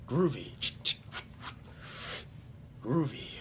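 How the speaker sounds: a hint of a rougher hero voice; lightly voiced action-hero catchphrase; Ash. Practising the Ash versus Evil Dead catchphrase, light impression. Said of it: "Is kinda rougher".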